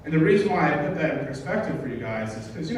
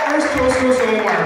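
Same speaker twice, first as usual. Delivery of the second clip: toast call; 8 semitones higher, 5 dB louder than usual. Real, not acted. Raising a toast to the room.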